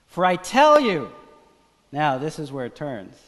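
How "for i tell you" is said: loud and high, driving the sermon home; stirred-up pulpit voice; fiery preaching. Acted. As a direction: preach it full force to the crowd. Preaching at full force.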